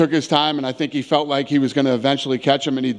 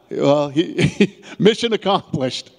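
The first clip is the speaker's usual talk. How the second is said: amused, higher-pitched through real laughter; laughing; 4 semitones higher, much slower than usual. Real, not acted. Promoter amused that the fighter's prediction came true, laughing.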